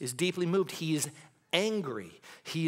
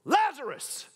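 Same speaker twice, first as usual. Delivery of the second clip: much higher and louder, calling out; commanding shout; 14 semitones higher, 5 dB louder, much slower than usual. Retold or imitated. Jesus calling Lazarus from the tomb, loud shout. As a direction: call him from the tomb at full voice. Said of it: "He says".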